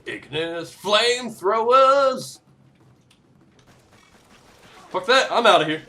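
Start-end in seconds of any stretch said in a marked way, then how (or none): 0.0-2.4 high, slow, drawn-out call; mock weapon announcement. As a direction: announce the weapon with hammed-up grandeur. Calls out weapon name playfully.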